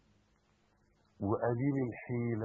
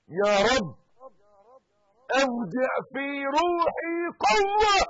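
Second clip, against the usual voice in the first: loud, high, slow supplication; fervent prayer; 8 semitones higher, 11 dB louder than usual. Shown as a demonstration. Preacher leading the congregation in prayer, loud. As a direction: plead for strength. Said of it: "قول".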